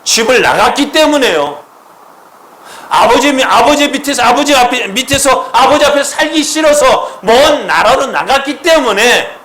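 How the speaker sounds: loud, high-pitched impassioned preaching; full-force preaching. Acted. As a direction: build it up and proclaim it with full force. Preaching climax. (높고 크게 외치는 설교)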